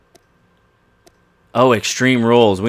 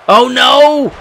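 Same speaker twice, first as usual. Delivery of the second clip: sudden high yelp of dismay; a real cry of alarm at the screen; 13 semitones higher, 3 dB louder than usual. Real, not acted. Gamer crying out as his wrestler gets hit, high and dismayed.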